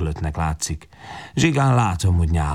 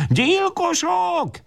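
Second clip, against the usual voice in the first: very high, slow drawn-out scream; shriek; much slower than usual. A narrator's reading. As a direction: shriek it in terror. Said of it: "és visítja". (visítva, elnyújtva)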